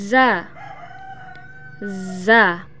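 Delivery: "Za" with a long buzzing z; wasp-like buzz. Shown as a demonstration. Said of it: "mirip dengan suara dengungan tawon".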